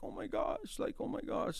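hushed, airy imitation of a woman; overwhelmed woman. Woman being prayed for, overwhelmed and hushed. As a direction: softly gasp it in her voice. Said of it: "she's just like".

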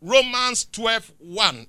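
loud, slow, drawn-out preacher cadence; preaching. Real, not acted. Preacher driving the congregation toward the closing scripture, loud and slow. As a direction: preach it over the music.

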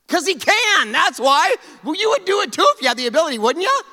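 high, loud, fast preaching burst; preaching at full force; loud excited preaching. Real, not acted. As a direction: build the energy up. Preacher riffing loudly.

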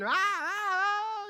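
high, drawn-out mock wail; imitated child's scream. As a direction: imitate a scared kid screaming. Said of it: "and he's screaming".

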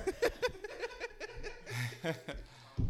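high, loud laugh; high burst of laughter. Real, not acted. Laughs before answering.

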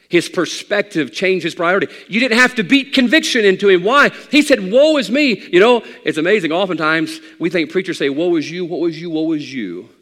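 loud, high, driving sermon climb; preaching at full force. Acted. Pastor preaching to his congregation, full force. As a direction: build it like a revival preacher.

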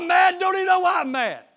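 high, loud mock-angry shout; acted mad outburst. Preacher playing an agitated believer to the congregation, mad outburst.

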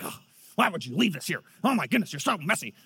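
put-on a flustered girl scolding her sisters; flustered daughter. Imitating his daughter grumbling over her sisters' toys, fussy. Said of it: "flusters, like".

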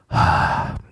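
voiceless, airy mock-weary sigh; acted sigh. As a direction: let out one long sighed "Ah". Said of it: "it's the year of the sigh".

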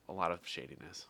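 conspiratorial stage whisper. Acted. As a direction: drop to a secretive whisper. Jokey aside about club scandal.

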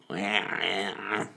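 mock toddler "wah, wah" in a small put-on voice; imitated baby wail. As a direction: mimic the baby crying back at dad. Toddler answers dad with crying.

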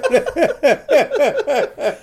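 bursting into high laughter. Real, not acted. Laugh at election.

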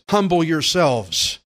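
normal talking voice

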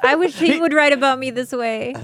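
high, loud laughing through the words. Real, not acted. Wife laughing at his sweet diary entry, loud giggle. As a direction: laugh while teasing.

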